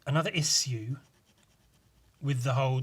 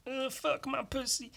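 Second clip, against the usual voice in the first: porn moaning; 12 semitones higher, 6 dB quieter than usual. Retold or imitated. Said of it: "it wasn't like".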